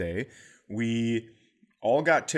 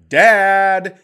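higher, louder shout of Dad; child calling; 8 semitones higher, 12 dB louder than usual. Retold or imitated. Kid on the beach yells for dad, loud and high. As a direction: call across the beach for your dad.

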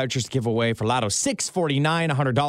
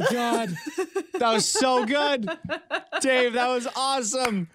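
laughing; 6 semitones higher than usual. Real, not acted. Delighted host reacting to gobble.

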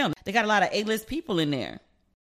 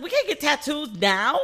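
retold protest; 7 semitones higher, 4 dB louder than usual. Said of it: "And I was like".

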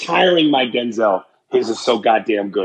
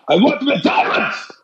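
a lightly voiced movie bad guy demanding; quick villain shout, higher; hint of a Bad Boys villain; 11 semitones higher than usual. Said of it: "them bad guys in them Bad Boy movies".

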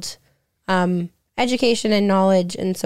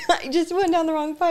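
laughing; 8 semitones higher, 4 dB quieter than usual. Real, not acted. Laughing after choking on water.

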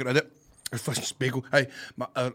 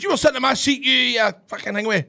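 raised, louder indignant retelling; mock indignant complaint; 9 semitones higher, 6 dB louder than usual. Podcast guest retells a man's seat complaint, mock indignant. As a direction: act out a man griping at you.

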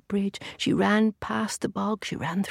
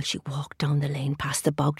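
ordinary delivery, nothing marked

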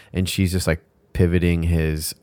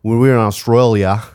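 Australian accent; 3 semitones higher, 6 dB louder than usual. Shown as a demonstration. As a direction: exaggerate Australian vowels round a sticky mouthful. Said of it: "Australians sound like English people with peanut butter in their mouth".

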